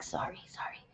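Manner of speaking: hushed quick whispered apology; whispered sorry. Real, not acted. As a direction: whisper it, too close to the mic. Apologizing for being near the mic.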